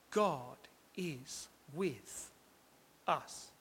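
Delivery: very soft, each word set apart; God … is with … us, near a whisper; hushed, spaced out. Shown as a demonstration. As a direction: let long silences fall between words. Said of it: "let's just pause together here".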